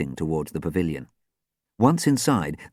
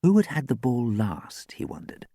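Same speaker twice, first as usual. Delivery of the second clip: plain reading voice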